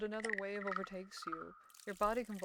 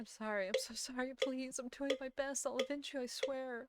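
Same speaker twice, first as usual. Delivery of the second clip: higher, beseeching acted voice; pleading apology; 6 semitones higher, 4 dB quieter than usual. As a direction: apologize desperately, begging to be believed. Character begs the dead lover's forgiveness.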